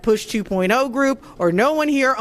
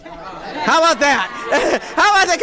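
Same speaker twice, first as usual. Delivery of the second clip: laughing; 7 semitones higher than usual. Real, not acted. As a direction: laugh it out in delight. Laughing at a guest's long drive.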